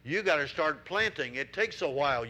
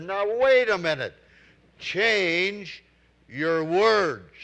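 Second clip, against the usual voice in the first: loud, firm correction; stern evangelist; 2 semitones higher, 7 dB louder, slower than usual. Retold or imitated. Evangelist cutting off the smoker, loud and stern. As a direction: stop him short and set him straight. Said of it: "He said".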